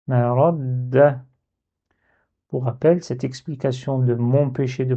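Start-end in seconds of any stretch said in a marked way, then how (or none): 0.1-1.2 MA RA DA slow, with a long held d; blocked on shadda. Shown as a demonstration. Teacher repeating the word with shadda, doubled d. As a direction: linger on the d after "ra" before opening "da". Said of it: "elle est là pour me bloquer".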